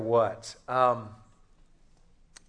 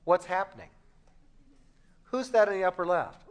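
pestering spouse; 5 semitones higher than usual. Acted. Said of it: "your spouse sits down next to you, and they ask".

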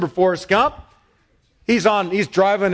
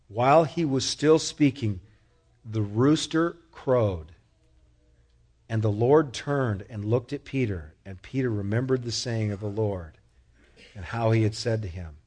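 ordinary talk

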